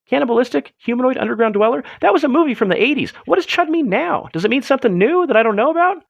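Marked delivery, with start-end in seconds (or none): none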